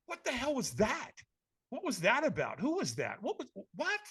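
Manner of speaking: hushed, baffled questions; baffled retold reaction; bewildered. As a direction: trail into a high, stunned "what". Bewildered after stranger vanishes.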